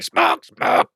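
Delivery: squeaky mock scream of an imp; gleeful little creature shriek, not full volume. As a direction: squeal it with wicked glee. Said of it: "starts gleefully screaming".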